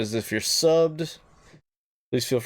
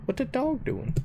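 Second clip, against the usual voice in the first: squeaky voice; 13 semitones higher, 5 dB quieter than usual. Pretend. Repeat, much higher.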